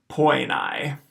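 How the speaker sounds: poenai said poy-nye; both diphthongs in poenai; diphthong demo. Shown as a demonstration. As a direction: model poenai carefully. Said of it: "with A E being pronounced I".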